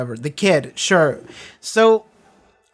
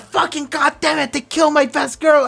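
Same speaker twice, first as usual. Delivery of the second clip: higher, mock furious curse; imitating an angry outburst; 9 semitones higher than usual. The Master smashing things after Darla dies, mock raging high. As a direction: play the villain raging over his loss. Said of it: "just breaking shit and being like".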